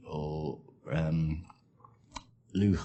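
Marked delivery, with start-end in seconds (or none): 2.6-2.8 "luch" with a rasping ch at the end; Scouse look. Shown as a demonstration. Liverpool speaker demonstrating the Scouse "luch". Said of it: "luch when they mean look".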